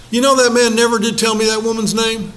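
usual speaking voice

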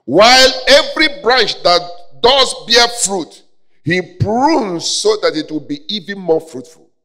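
loud, high preacher's proclamation; ringing pulpit delivery; full-force preaching. Real, not acted. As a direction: proclaim the verse loudly, easing off toward the end.

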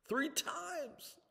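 high, ringing despair; high anguished cry. Acted. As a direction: cry out the count in pain. Peter counts his denials.

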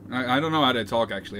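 usual speaking voice

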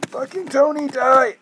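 high, loud swearing rage; angry outburst. Real, not acted. Gamer raging at his character, loud and angry. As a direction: snap angrily at the screen.

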